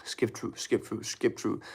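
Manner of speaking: near-whispered, low muttering; very soft, private mumble to oneself; muttered impatient thought. Acted. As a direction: mutter it under the breath, barely voiced. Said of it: "you're like".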